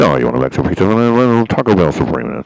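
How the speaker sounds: loud, fast drive-thru speaker voice; like a crackly drive-thru intercom. Retold or imitated. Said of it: "this is what they sound like".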